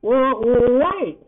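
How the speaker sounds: high, loud plea to stop; urgent cry. A narrator's reading. Poppy stopping Yarif as he leaves, crying out. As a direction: cry out to halt someone leaving. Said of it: "her fearful trance now broken".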